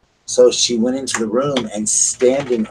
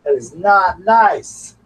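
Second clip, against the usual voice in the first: slow, higher, louder firm scold; scolding the dog; 8 semitones higher, 4 dB louder, much slower than usual. Real, not acted. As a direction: spell it out to the naughty dog.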